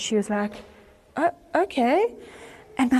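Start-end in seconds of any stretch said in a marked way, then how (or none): 1.2-2.1 tentative, high rising reply; hesitant agreement. Retold or imitated. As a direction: agree uncertainly, voice lifting at the end. Woman's hesitant retold reply.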